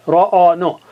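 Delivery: ordinary talk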